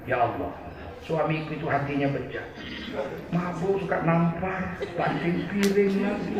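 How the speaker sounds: wife murmuring a prayer against her husband; quiet prayer. Retold or imitated. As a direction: pray under your breath. Wife praying over the food.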